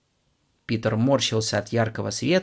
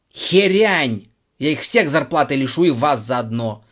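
furious shout; 4 semitones higher, 7 dB louder than usual. Acted. Director explodes in rage.

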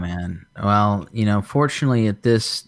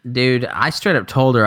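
ordinary talk, nothing marked

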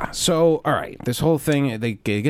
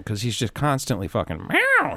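ordinary talk, nothing marked

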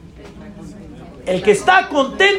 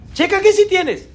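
higher, ringing repeat of qué sí tienes; 6 semitones higher than usual. Shown as a demonstration. Preacher repeating the line to the congregation, pitched up high. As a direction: lift qué up high and call it out.

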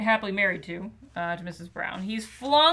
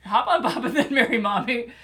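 laughing; 5 dB louder than usual. Real, not acted. Laughing repeat.

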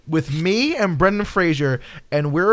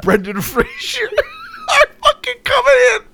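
mock trailer voice; 4 semitones higher than usual. Pitching the show to Gabby, mock trailer announcer. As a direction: sell it like a movie trailer.

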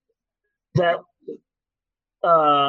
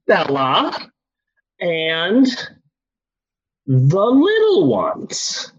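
halting roll call with swooping pitch; stoned dad; 3 semitones higher, much slower than usual. Retold or imitated. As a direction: grope slowly for each name. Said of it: "And I go".

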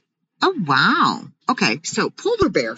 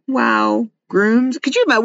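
usual speaking voice

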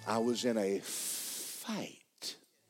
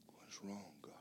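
weeping whisper; 18 dB quieter than usual. Retold or imitated. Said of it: "and I began to weep".

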